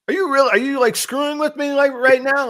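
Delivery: telling the developer off in high-pitched disbelief; incredulous. Retold or imitated. Retold incredulous question to developer.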